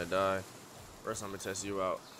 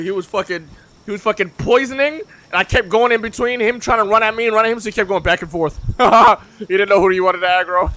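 excited; 6 semitones higher, 10 dB louder than usual. Real, not acted. Excited retelling of fight.

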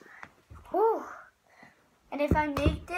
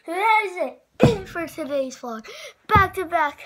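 excited; 5 semitones higher, 7 dB louder than usual. Real, not acted. Still hyped after the win.

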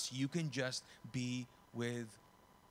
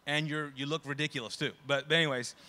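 ordinary talk

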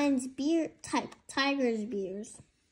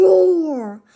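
loud, higher roaring roar; big roar; 3 semitones higher, 10 dB louder than usual. Shown as a demonstration. Tig shows Lily a real tiger roar, loud roar. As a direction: let it rip like a tiger. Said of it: "let me hear you roar like this".

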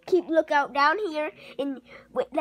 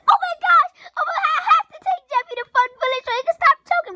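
much higher and louder, rushed mock panic; imitating panicked dad; 13 semitones higher, 6 dB louder, faster than usual. Kid imitating his dad rushing to save choking son, high and fast. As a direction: squeal it fast like a panicking father. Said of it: "He's like".

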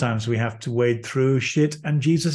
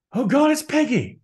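sudden realization; 10 semitones higher than usual. Retold or imitated.

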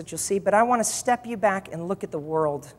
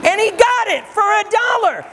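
high, exultant proclamation; triumphant preaching shout; 11 semitones higher, 4 dB louder than usual. Real, not acted. Preacher revealing Spurgeon got the building, triumphant shout. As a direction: shout the punchline to the congregation.

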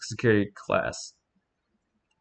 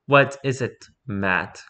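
ordinary talk, nothing marked

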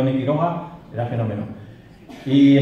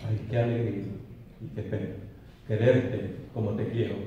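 low, very soft refrain; poem recitation; 5 semitones lower, 12 dB quieter than usual. Acted. The lover sighs over secret love, hushed and sorrowful recitation. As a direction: let it out low, nearly fading away.

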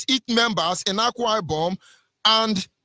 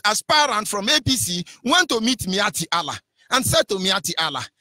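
ordinary delivery

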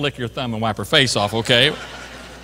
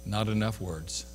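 soft faded echo; 7 semitones lower, 12 dB quieter than usual. Retold or imitated. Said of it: "she whispered".

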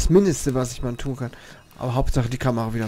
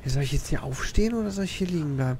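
ordinary talk, nothing marked

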